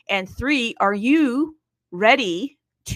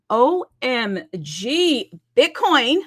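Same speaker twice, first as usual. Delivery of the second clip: excited; 4 semitones higher, 5 dB louder, much slower than usual. Real, not acted. Host hyping Bitcoin.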